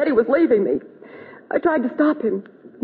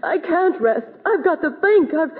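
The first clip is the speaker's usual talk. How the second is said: tearful; 5 semitones higher, slower than usual. Acted. Distraught woman after a shooting.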